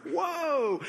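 a very high, stretched whoa of surprise; amazed whoa. Retold or imitated. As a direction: let the whoa leap up in disbelief. Amazed at no payment.

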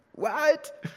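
high-pitched incredulous "what?"; baffled. Acted. Preacher reacting to a puzzling verse, baffled. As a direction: squeak it out in mock disbelief.

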